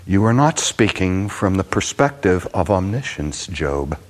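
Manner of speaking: ordinary talk, nothing marked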